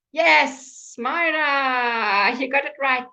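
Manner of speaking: bright, loud, high praise; excited praise. Real, not acted. Teacher praising Myra for the right answer, delighted. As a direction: cheer the student on.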